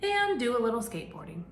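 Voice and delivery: animated picture-book narration; reading to children. Acted. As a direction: read it out to a class of little kids. Reads picture book to class.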